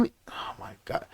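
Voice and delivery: incredulous whisper, breath only. Real, not acted. Whispering in disbelief at a silly divorce reason. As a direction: hiss it in disbelief to the viewers.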